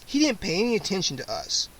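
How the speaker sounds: usual speaking voice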